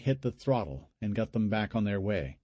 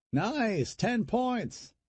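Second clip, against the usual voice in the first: gleeful quip; 13 semitones higher, much slower than usual. A narrator's reading. Brother's gleeful one-liners over corpses.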